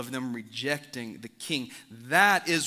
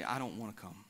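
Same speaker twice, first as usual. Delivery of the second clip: low and much softer, cold and dismissive; contemptuous refusal; 4 semitones lower, 4 dB quieter than usual. Acted. An invitee coldly tells the host no, in a low, soft voice. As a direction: turn the invitation down like the host isn't worth it. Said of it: "they say".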